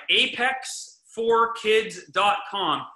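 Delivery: put-on apex four kids dot com said slowly, each word separate; slow, clear web address. Shown as a demonstration. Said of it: "A P E X".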